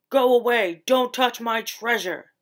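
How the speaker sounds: loud growling lobster voice; growled. A narrator's reading. Lobster growling at the little fish to go away. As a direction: bark it out to guard the treasure. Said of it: "the lobster growled".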